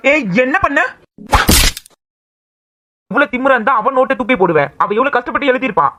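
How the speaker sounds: angry scolding. Acted. Brother scolding sister for throwing the notes, angry. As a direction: tear into her for throwing the notebook.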